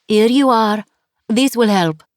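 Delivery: put-on 2 cheerful landlady with a heavy French accent; 3 greet her warmly in thick French-accented English; 4 French guest-house owner, heavy French accent. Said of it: "her accent was heavy".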